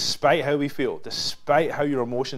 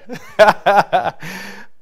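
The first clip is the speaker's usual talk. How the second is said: big high laugh at own joke; loud burst of laughter; 9 semitones higher, 11 dB louder than usual. Real, not acted. Laughing at viewers who feel offended.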